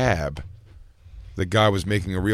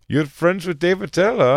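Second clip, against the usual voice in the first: slow, high mock Indian accent; 7 semitones higher than usual. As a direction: imitate a curious Indian cab driver. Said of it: "with an accent like that".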